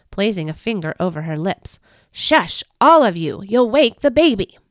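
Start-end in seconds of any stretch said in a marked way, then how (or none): 2.1-4.6 put-on louder scolding hush from the old woman. Old woman hushing the shepherds outside, louder and scolding. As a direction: hush them before they wake the baby.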